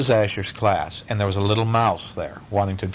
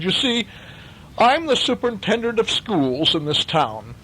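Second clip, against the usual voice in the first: superintendent; 10 semitones higher than usual. A narrator's reading. Superintendent voiced in reading.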